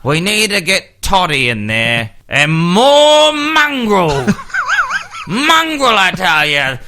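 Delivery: mocking Canterbury fans. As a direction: ham up the thick rugby fan chanting for mongrel. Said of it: "these dickheads from Canterbury going".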